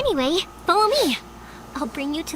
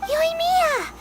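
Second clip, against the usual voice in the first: excited call to a friend; 14 semitones higher than usual. Acted. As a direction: shout her name across the crowd. Calling out to Yoimiya.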